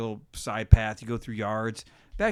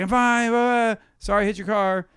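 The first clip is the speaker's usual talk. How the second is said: loud, high retold holler; acted call-out, pitched up; calling after car; 10 semitones higher, 6 dB louder than usual. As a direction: shout an apology after a departing driver. Calls after departing car.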